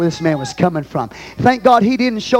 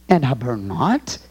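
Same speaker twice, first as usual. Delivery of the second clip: "And I burn not" slow, rising sharply on "not"; questioning rise; 5 semitones lower, slower than usual. Shown as a demonstration. Preacher reading the apostle's question, "not" rising. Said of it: "It's a question mark".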